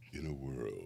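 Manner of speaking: low trailer-announcer voice; the classic trailer opener; movie trailer voice. Retold or imitated. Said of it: "He always set it up where he's like".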